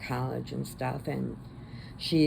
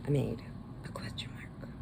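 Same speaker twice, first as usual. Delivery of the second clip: whispered; 3 semitones lower, 9 dB quieter than usual. Real, not acted. Showing her crochet to the camera.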